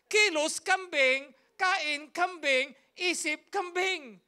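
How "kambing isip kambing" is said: usual speaking voice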